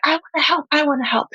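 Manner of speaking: put-on high, eager child voice; eager little girl. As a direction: pipe up like an excited little kid. Mother voices eager little daughter.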